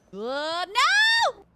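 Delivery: very high, loud scream; startled scream. Real, not acted. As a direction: shriek as you slip. Cat falling in game.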